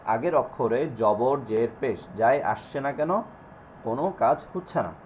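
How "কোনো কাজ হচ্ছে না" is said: ordinary talk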